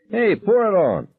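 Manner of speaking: very high taunting yell. A narrator's reading. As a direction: egg them on from the desk. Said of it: "Billy Sawyer yelled".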